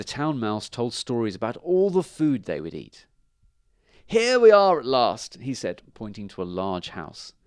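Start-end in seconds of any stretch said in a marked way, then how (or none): 4.1-5.4 high, loud announcement; proud arrival. A narrator's reading. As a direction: Town Mouse showing off home. Town Mouse announces the arrival.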